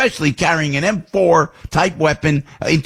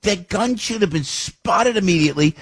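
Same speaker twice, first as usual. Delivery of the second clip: high-pitched, exasperated rant; indignant outburst; 7 semitones higher than usual. Real, not acted. Host venting about unused facial recognition technology, indignant. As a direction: demand answers with the pitch swinging up high.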